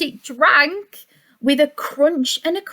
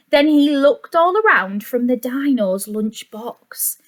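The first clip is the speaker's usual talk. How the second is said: ordinary delivery, nothing marked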